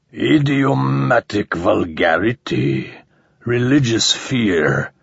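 grind it out like a rusty machine; rusty metallic alien. Acted. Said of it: "disturbingly rusty and metallic".

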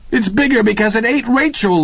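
high, frightened cry. Acted. Laverne crying out to the others in terror. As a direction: voice leaps up in terror. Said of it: "Laverne cried".

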